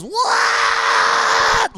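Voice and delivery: long, very high blaring shout; imitated piercing noise. Showing co-hosts the battle's bad sound, piercing blare. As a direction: blast it out like piercing bad audio. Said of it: "the sound was bad on that one".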